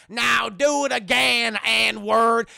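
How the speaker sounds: loud, high, slow barked command; shouted order. Retold or imitated. Overseer shouting a slow order at the slave. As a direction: yell it down at him.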